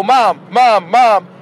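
put-on whiny kid calling. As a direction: keep calling like a kid who won't give up. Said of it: "listen to your kid go".

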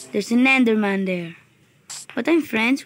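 ordinary talk

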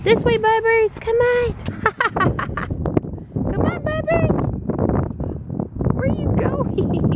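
high coaxing sing-song; sing-song call. Real, not acted. Mom calling her toddler away from the water.

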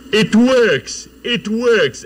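high, loud cry of triumph; excited shout. Retold or imitated. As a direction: shout it out as the machine plays back. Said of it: "everyone shouted".

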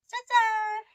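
high bright ta-da; excited unboxing reveal. Real, not acted. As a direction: announce the reveal with a flourish. Revealing the plant to viewers.

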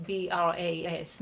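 ordinary talk

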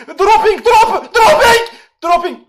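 high, loud shouted dropping, laughing; frantic shout. Real, not acted. Streamer yelling to chat that his computer is freezing. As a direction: yell the English word out in a panic.

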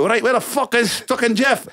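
a furious Scottish kit man; angry Scottish guy. Retold or imitated. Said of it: "angry Scottish guy".

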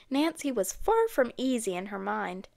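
ordinary delivery

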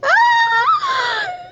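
real helpless, very high laugh; high squealing laugh. Real, not acted. Overcome by the funny photo.

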